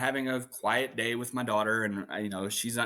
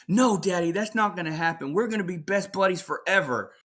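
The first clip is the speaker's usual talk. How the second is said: put-on higher, louder pleading child; little daughter; 8 semitones higher, 3 dB louder than usual. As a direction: insist like a clinging little girl. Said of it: "she's like".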